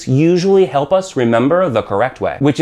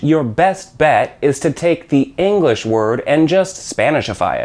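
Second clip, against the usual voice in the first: usual speaking voice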